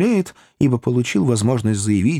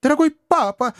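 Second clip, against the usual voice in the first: put-on much higher pleading girl's cry; Emily exclaiming. A narrator's reading. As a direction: beg her father. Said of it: "воскликнула Эмили". (Эмили умоляюще, намного выше)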